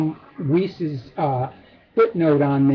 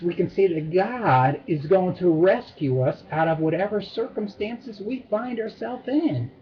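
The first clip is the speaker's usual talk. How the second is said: ordinary talk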